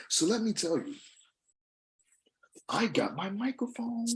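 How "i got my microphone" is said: whispered aside, like a secret. Real, not acted. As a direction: drop to a sudden whisper.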